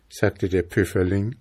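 ordinary delivery, nothing marked

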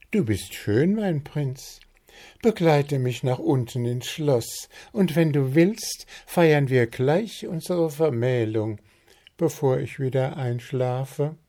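high, swooning princess voice; falsetto princess. A narrator's reading. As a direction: flirt sweetly, a bit hammed-up.

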